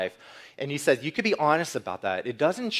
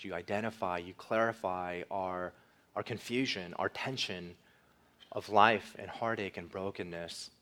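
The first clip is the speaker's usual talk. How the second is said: soft, low, measured praying voice; hushed, unhurried prayer, phrase by phrase; closing prayer; 3 semitones lower, 6 dB quieter than usual. Real, not acted. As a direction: pray gently to God on the congregation's behalf.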